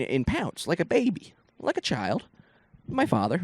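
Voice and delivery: usual speaking voice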